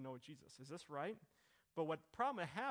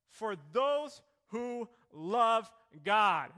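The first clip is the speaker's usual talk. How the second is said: those stretched out slow and loud; stress on those; 4 semitones higher, 12 dB louder, much slower than usual. Shown as a demonstration. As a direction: lean hard on "those who love God".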